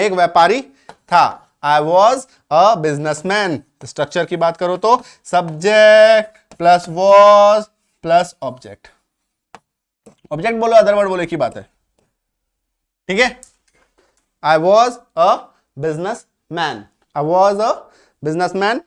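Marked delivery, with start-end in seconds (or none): 1.6-3.6 very slow, clear teacher model; slow model sentence. Shown as a demonstration. Teacher modelling example slowly. 5.2-8.9 drawn out, louder structure read; slow dictation. Shown as a demonstration. 14.4-18.8 very slow, clear teacher model; slow model sentence. Shown as a demonstration. Teacher modelling example slowly.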